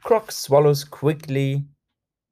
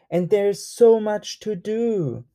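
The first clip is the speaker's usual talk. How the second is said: usual speaking voice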